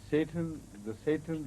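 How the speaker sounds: usual speaking voice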